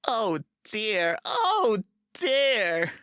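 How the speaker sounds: sad engine. A narrator's reading. James in the shed, sorry for himself, sadly wailing. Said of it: "he thought sadly".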